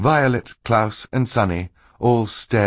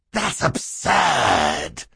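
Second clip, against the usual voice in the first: very drawn-out villain's outcry; higher, slow put-on voice crying out; indignant cry; 4 semitones higher, much slower than usual. A narrator's reading. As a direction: cry out in stretched, theatrical protest. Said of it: "Stefano cried".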